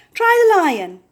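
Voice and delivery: quick chirpy bird calls. A narrator's reading. Birds call out other animals to try, squawky.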